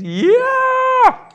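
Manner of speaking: very high, loud, drawn-out yeah; the body approving. Pretend. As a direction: let the body sing its approval. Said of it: "your body goes".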